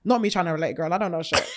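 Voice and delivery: ordinary delivery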